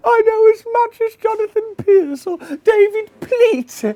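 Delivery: very high, loud and slow boast; gloating shout. Pretend. Viewer boasting to the room, very high and slow. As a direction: crow it out slowly.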